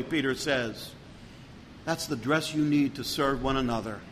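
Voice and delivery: ordinary talk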